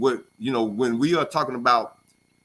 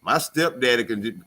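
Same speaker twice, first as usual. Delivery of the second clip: ordinary talk, nothing marked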